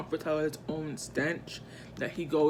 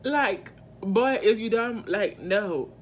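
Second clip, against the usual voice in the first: exasperated outburst; 4 semitones higher, 5 dB louder than usual. Retold or imitated. Exasperated at boy's advances.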